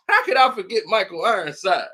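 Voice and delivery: usual speaking voice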